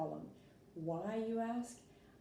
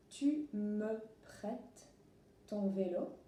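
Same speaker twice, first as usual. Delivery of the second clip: very slow, careful French read-out; 3 semitones higher, slower than usual. Shown as a demonstration. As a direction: read the French slowly for learners. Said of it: "We'll start with the sentence".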